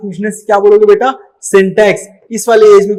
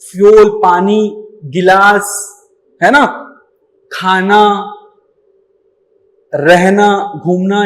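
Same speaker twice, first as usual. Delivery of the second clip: slow teaching; slower than usual. Shown as a demonstration. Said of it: "उसको हम लोगों ने क्या सिखाया".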